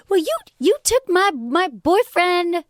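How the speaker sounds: put-on high, drawn-out botched Jersey Shore accent. Crying girl accuses her friend over a boyfriend, botched Jersey accent. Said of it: "that's the wrong accent".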